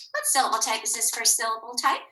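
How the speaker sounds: normal talking voice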